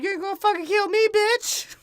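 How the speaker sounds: high mock villain shout. Mocking the villain's threat, high mock shout. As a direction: squeal it out like a cartoon villain.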